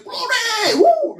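high excited cry of glory; joyful shout. Retold or imitated. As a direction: shout it out in joy.

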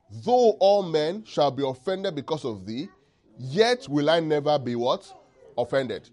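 preacher booming the verses; preaching at full force. Acted. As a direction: proclaim it to the whole church.

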